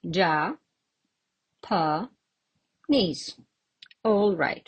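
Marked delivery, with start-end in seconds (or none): 0.0-3.3 counting out syllables with pauses between; teacher sounding out each syllable. Shown as a demonstration. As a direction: break the word into separate beats. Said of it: "How many syllables are there?".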